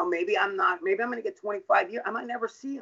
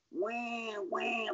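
high, softer mock baby wail; teasing; 5 semitones higher, 9 dB quieter than usual. Foster father mocking the crying girl, teasing. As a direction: mimic a crybaby to taunt. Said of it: "like, teasing her".

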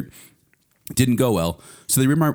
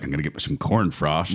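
mock farmer; 7 semitones lower than usual. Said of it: "in the morning and be like".

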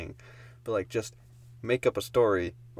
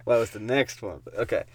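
ordinary talk, nothing marked

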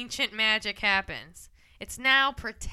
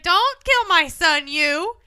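mock pleading; 4 semitones higher, 6 dB louder, slower than usual. Voices mother pleading with killer.

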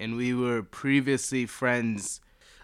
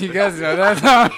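high laughing question; 10 semitones higher, 12 dB louder than usual. Real, not acted. Laughing at own joke.

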